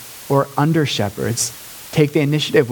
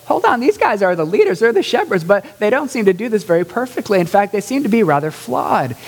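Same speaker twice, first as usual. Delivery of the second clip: puzzled Bible reader; 7 semitones higher than usual. Retold or imitated. Listener voiced reading about flawed leaders, mildly puzzled. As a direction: voice pitched higher, mildly puzzled. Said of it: "you'll go".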